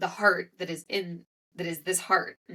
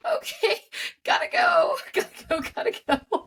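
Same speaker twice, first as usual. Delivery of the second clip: much higher, louder, laughing through tears; flustered getaway; 4 dB louder than usual. Retold or imitated. Rushing away from the kind teacher, high and laughing through tears. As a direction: squeak it out laughing and fleeing. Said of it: "And I was like".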